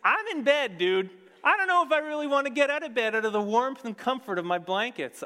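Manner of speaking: high, loud, put-upon woman's voice; bride's voice. Acted. Bride paraphrased to her lover, high and put-upon. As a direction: complain like someone cosy in bed. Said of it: "What she's saying is".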